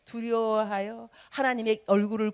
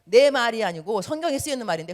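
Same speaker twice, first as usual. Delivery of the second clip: ordinary delivery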